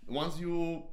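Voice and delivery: ordinary delivery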